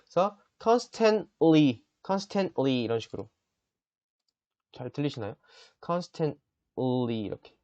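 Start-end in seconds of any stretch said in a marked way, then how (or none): 0.6-1.8 constantly, very slow, t cut off before ly; constantly with a held-back t; silent t demo. Shown as a demonstration. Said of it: "맨 뒤에 있는 요런 거는 약간 묵음". 5.9-7.2 constantly, very slow, t cut off before ly; constantly with a held-back t; silent t demo. Shown as a demonstration. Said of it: "한번 딱 끊어주시고".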